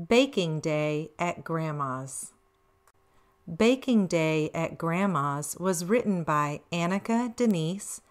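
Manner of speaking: low, soft reading of the title page; calm title reading. A narrator's reading. Grandma reading the title to little grandkids, low and calm. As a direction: announce the book gently.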